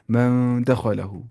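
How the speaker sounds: slow "من" held nasal, then even "دا خا لا ہو"; nasal ikhfa. Shown as a demonstration. Teacher shows how to read it, nasal ikhfa. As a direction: hum the noon of "من" before "دا خا لا ہو". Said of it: "ایسے پڑھنا ہے".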